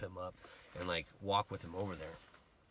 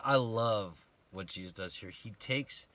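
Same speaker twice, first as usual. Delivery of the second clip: ordinary talk, nothing marked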